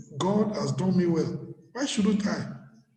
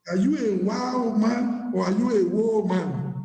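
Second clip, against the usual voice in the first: ordinary delivery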